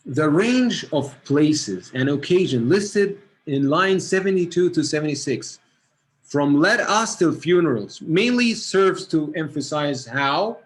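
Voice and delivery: a teacher reading the exam question aloud, slow and loud; reading aloud to the class. Shown as a demonstration. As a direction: read it out clearly for the whole class. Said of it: "what I'm gonna read here".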